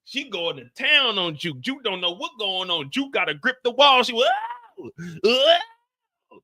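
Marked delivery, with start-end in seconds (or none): 4.1-6.4 squealing whoa, very high; high mock cry. Juke overwhelmed in the bathroom, squealing whoa.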